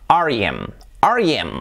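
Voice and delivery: "R E M" said as ar-i-em; English-style ar-ee-EM; English letter names. Shown as a demonstration. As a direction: spell R E M in English as one flow. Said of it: "En inglés".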